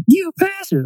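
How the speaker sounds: very high squealed quote; mock cartoon catchphrase. Somebody butting into a conversation, high mock squeal. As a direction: pipe up in squeaky cartoon indignation. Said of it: "Somebody shows up out of nowhere".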